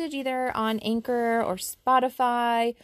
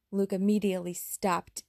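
usual speaking voice

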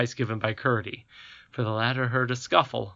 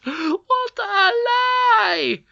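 very high, very slow furious shout; roar in rage; 8 dB louder, much slower than usual. A narrator's reading. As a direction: roar it out in fury. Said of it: "roared the Queen in rage".

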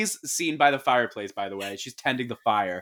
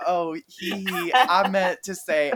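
higher-pitched, stalling fake giggle; mock awkward backtracking; 7 semitones higher than usual. Retelling a man covering up before children, mock sheepish backtrack.

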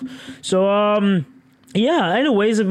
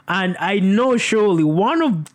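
usual speaking voice

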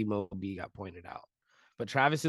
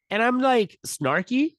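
excited; 7 semitones higher, 5 dB louder, slower than usual. Real, not acted. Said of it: "excited recounting".

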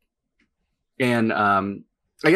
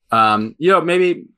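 talking through a mouthful of food; words muffled by a bite of food; mouth full; 7 semitones higher, 6 dB louder than usual. Real, not acted. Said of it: "sorry, I'm talking with my mouth full".

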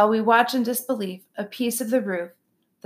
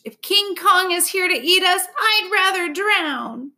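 panicked bunny; 9 semitones higher than usual. A narrator's reading. Frightened bunny cowering.

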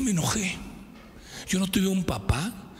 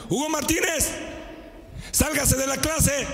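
higher, stern teacher voice; mock teacher; 8 semitones higher than usual. Teacher ordering Hugo Martínez out of class. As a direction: order him out like a fed-up teacher. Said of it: "Cuando estaba escribiendo decía".

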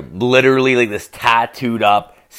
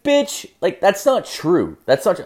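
retold indignant retort; 7 semitones higher than usual. Arguing with coworker about banks.

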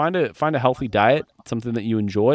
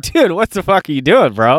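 incredulous yell; 7 semitones higher, 7 dB louder than usual. Retold or imitated. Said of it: "I'm like".

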